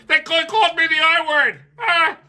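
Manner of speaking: high, loud whiny mock governor; hammed-up wounded whine; shrill mock complaint; mocking Walz. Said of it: "Walz referred".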